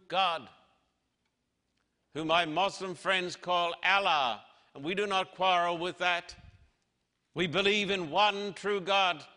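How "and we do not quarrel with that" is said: ordinary delivery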